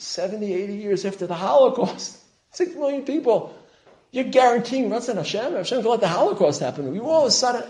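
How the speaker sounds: high, loud burst of incredulous protest; astonished. Retold or imitated. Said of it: "I was just astonished".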